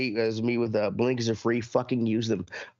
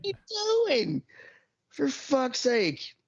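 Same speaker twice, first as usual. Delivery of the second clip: much higher and very slow, stretched in disbelief; drawn-out mock exasperation; 13 semitones higher, much slower than usual. Venting about a driver's late blinker, slow high whine. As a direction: drag every word out in a high exasperated whine. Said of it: "I'm like".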